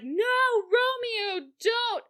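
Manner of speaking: high, tearful mock wail; desperate plea. She runs in to stop Romeo, desperate. Retelling Juliet crying out to Romeo.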